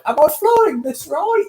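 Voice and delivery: very high, squealed joking question; mock pilot panic. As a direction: act out a clueless pilot, voice shooting up. Imagines clueless self piloting the jet.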